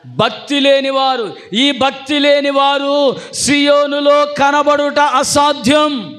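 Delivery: slow, high, shouted preaching. Acted. Pastor warning the congregation, slow high shout. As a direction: drag each word out like a verdict.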